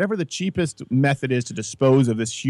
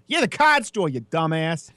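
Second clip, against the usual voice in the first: put-on Boston accent; 3 semitones higher than usual. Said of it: "they were severe Boston accents".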